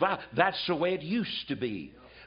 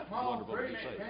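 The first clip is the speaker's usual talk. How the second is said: mocking it back, much higher and far softer; sarcastic echo; 7 semitones higher, 8 dB quieter than usual. Preacher mocking a hypocrite's pious line, sarcastic. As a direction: throw their words back with scorn. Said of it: "don't you show your dirty face".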